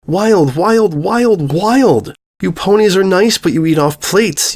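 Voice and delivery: put-on a young girl's high, insistent outburst; upset girl. Mia telling the princess the ponies aren't wild enough, upset. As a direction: pour out the frustration, stressing wild each time.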